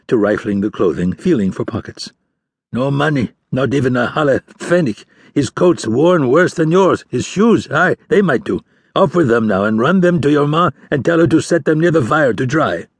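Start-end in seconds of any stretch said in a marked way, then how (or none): 2.7-12.9 put-on gruff old fisherman. Old fisher going through the dead man's clothes and ordering his son. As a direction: order the boy about like a hard old father.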